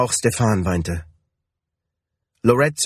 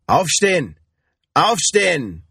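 higher-pitched shouted order, falling at the end; raised-voice command from a crewman; 6 semitones higher than usual. A narrator's reading. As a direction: order someone up with a restrained shout. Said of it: "schrie der Mann".

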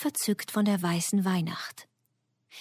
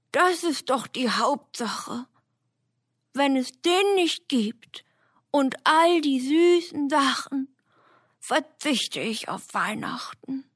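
higher, pleading bear with a whisper; 7 semitones higher than usual. Acted. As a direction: beg for the sweets like a bear. Bär spricht.

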